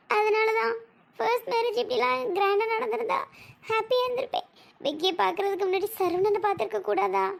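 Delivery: high-pitched, crying complaint; tearful lament. Acted. Crying bride laments her second marriage to her friend, tearfully. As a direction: pour out your regret through tears. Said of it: "ஏன் டீ இப்படி அழுதுகிட்டே இருக்காஞ்சு".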